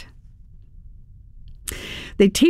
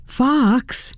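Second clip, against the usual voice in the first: higher-pitched call to a friend; 7 semitones higher than usual. A narrator's reading. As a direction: call his name to get his attention. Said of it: "I called to my curled-up friend".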